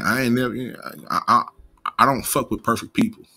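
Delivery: normal talking voice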